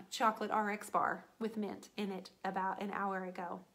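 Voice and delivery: ordinary talk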